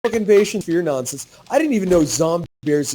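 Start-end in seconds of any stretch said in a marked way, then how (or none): 0.1-1.4 laughing brush-off. Retold or imitated.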